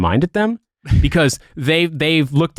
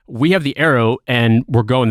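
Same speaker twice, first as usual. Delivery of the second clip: usual speaking voice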